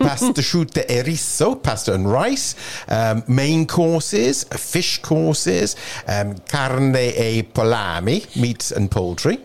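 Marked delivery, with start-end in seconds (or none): 0.0-1.6 higher, hammed-up Italian accent; amateur's Italian menu reading. Shown as a demonstration. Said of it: "in an Italian accent". 6.5-8.2 slow put-on Italian accent. Shown as a demonstration. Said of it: "in an Italian accent".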